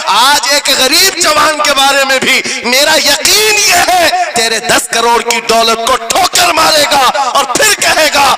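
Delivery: loud, high rally oratory; impassioned sermon at full force; fiery preacher. Real, not acted. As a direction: hurl it at the crowd.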